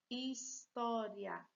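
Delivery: drawn out, each syllable separated; slow syllable-by-syllable reading. Shown as a demonstration. Teacher reading a word to pupils, slowly by syllables. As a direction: spell it out slowly for the class. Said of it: "A próxima palavra é história".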